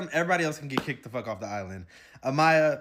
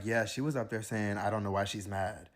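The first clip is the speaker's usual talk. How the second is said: low, hushed gossipy imitation; lower, softer, catty retelling; mock gossip voice; 7 semitones lower, 6 dB quieter than usual. Imitating Andrena's gossip, low and hushed. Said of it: "was like".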